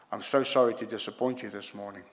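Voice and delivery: ordinary talk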